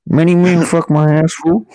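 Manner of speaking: a hammed-up Mexican accent. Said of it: "a Mexican accent for many men".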